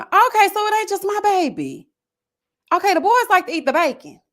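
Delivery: high, loud, animated exclamation; excited relief. Real, not acted. Host reacting to a viewer's comment about her bacon-loving son, excited.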